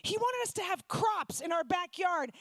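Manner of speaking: ordinary delivery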